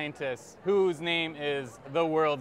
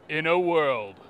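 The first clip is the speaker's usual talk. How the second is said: movie trailer narration; 4 dB louder than usual. Acted. Author pitches his cat book, movie trailer voice. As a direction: draw it out like an epic film trailer.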